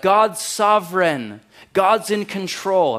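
slow, loud, high mock piety; mocking churchy slogan, slow and loud; churchy cliché. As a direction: drag out the pious slogan. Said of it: "they drop churchy language like".

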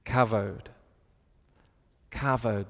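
kavod said as KA-vode, stress on the first syllable; Hebrew word kavod. Shown as a demonstration. Preacher teaching the congregation a Hebrew word, careful pronunciation. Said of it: "this Hebrew word for God's glory".

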